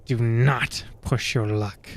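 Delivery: slow goblin threat under the breath; goblin hiss. Acted. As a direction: warn him off, slowly and low. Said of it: "he hisses at you under his breath".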